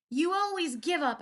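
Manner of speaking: high, loud angry shout. A narrator's reading. Daughter shouting at her father, high and loud. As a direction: hurl it at him. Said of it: "I shouted".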